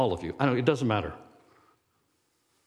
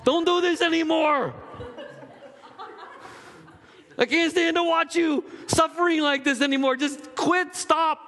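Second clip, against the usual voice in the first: Job's wife. Acted. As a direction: plead at the top of a squeaky mock-wife voice. Acting out Job's exasperated wife.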